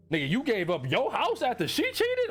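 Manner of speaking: high-pitched, incredulous question. Real, not acted. Reader to the story's writer about giving up the house, incredulous. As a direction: react in real disbelief, pitch jumping up.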